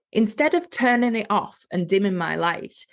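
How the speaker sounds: ordinary talk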